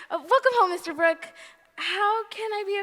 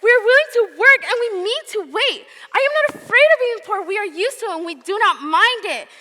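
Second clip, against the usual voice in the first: indignant, raised and quick; angry; 4 semitones higher, 10 dB louder than usual. Acted. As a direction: defend him with fierce indignation. Meg defends John to aunt.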